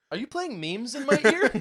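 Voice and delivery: high, loud amused disbelief; incredulous girlfriend. Retold or imitated. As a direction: ask like a stunned girlfriend. Girlfriend quoted, laughing.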